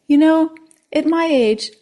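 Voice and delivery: lightly voiced dry old man; a hint of a wry old fellow. Old man explaining to the frog.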